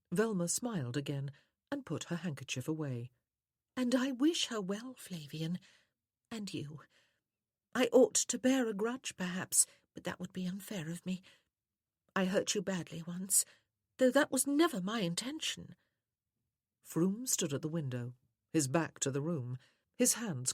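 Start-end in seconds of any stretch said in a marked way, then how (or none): 3.8-15.8 high feminine voice, dropping to near-whispers. A narrator's reading. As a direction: speak as a sweetly wounded lady. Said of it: "how soft and sweet her voice was".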